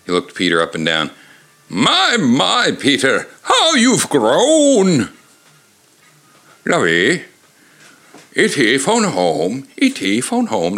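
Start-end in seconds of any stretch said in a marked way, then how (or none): 1.7-5.1 put-on high, slow, wavering old man; old grandpa voice. A narrator's reading. Grandpa Archie voiced in story. 6.6-10.8 lightly voiced posh old millionaire as the alien; slow, drawn-out phone home; Thurston Howell doing E T. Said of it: "Thurston Howell the third doing E T".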